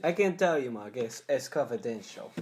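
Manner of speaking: put-on oddly accented, stilted actor; hammed-up stilted movie delivery; Tommy Wiseau. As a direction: refuse like an awkward bad-movie actor. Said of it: "Tommy Wiseau is a genius".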